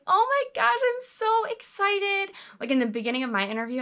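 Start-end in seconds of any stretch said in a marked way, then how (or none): 0.1-2.4 much higher, gushing and bright-eyed; over-the-top excitement. Shown as a demonstration. Advising applicants how to sound on the interview, gushing excitement. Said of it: "Be like".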